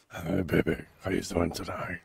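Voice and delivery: low, quiet put-on flirty voice; Shaniqua voice. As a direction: flirt softly in a pretend low voice. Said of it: "that's my Shaniqua voice".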